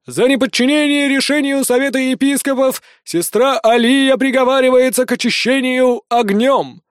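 high, loud proclaiming herald; town crier. A narrator's reading. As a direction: call out the sentence to the crowd. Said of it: "прозвучал голос глашатая".

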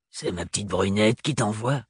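lightly voiced throaty crone; a hint of a throaty foreign woman; throaty old woman. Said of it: "Elle parlait fort de la gorge".

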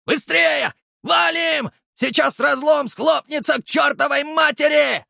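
high, loud frantic yelling; urgent shout. A narrator's reading. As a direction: yell at them to run. Fleeing collapsing rift, urgent.

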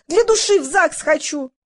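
high-pitched plea; squealing. A narrator's reading. Valya insisting she wants to marry for love, squealing. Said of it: "завизжала Валя".

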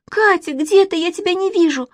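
put-on high, panicky little girl; high-pitched, wailing questions; frightened cry. A narrator's reading. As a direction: call anxiously through the dark. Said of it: "закричала Манечка".

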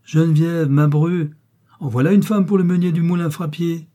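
high, loud indignation; scornful outrage. A narrator's reading. Mother mocking the match for her miller son, scornful.

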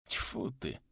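hushed "tfu" as if spitting; spitting in disgust. A narrator's reading. Traveller spits and crosses himself, spitting softly. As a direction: spit it out under your breath. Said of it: "Сплюнув, перекрестился тот".

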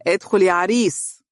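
lightly teasing man's call; cheerful. A narrator's reading. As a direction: invite him in merrily. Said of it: "وقال بمرح".

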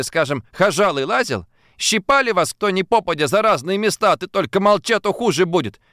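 a girl's mocking tirade; Simka scolding. A narrator's reading. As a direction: let her flare up at the men. Simka rebukes Fadeykin.

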